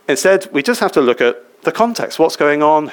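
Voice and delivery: usual speaking voice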